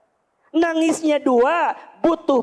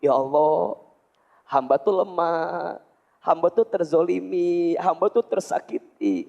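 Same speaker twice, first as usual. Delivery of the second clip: heartfelt complaining prayer; 6 semitones lower than usual. Shown as a demonstration. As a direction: pour your heart out to God. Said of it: "Bunda kudu belajar gitu".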